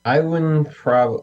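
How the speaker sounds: ordinary delivery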